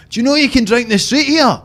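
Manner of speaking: high, loud mock Glasgow tourist accent; Glaswegian mimic. Mimicking people from Glasgow marvelling at Edinburgh in a high Glaswegian accent. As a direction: ham up a Glasgow visitor's amazement, pitched high. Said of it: "you'll just hear them going like".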